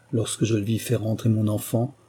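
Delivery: plain reading voice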